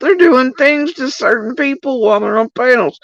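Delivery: high, sarcastic mock amazement; sing-song sarcasm at the mods' tricks; sarcastic mock wonder. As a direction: feign delight at the trick. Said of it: "I was like".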